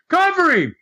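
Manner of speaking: insist, pushing the words up. Retold or imitated. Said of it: "he says it louder".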